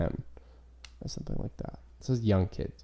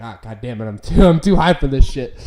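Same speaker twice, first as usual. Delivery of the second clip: imitated stoner outburst, building louder; retold snapping out; 11 dB louder than usual. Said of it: "And then the kid snapped out".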